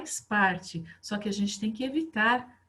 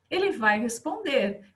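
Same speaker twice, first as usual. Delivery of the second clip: ordinary talk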